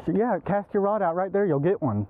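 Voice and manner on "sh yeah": usual speaking voice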